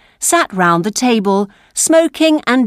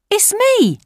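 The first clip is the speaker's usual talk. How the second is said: put-on high street-boy voice; breezy young Dodger; cheeky boy; 13 semitones higher than usual. A narrator's reading. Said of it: "said the Dodger".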